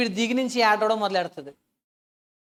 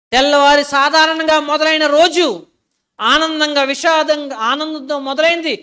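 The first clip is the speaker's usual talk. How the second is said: loud, slow, higher sermon build; full-force preaching; 4 semitones higher, 10 dB louder, slower than usual. Acted. Preacher warning the congregation, loud and slow. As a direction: hammer each phrase to the crowd.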